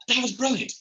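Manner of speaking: high, bewildered mock frustration; puzzled. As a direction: squeak it out, baffled at your own lost idea. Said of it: "And you're like".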